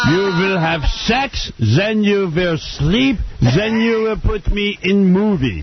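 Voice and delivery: high, very slow German commands; mock German accent. Friend mocking the German girl's orders, very slow mock German accent. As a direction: dictate each step like a cartoon German.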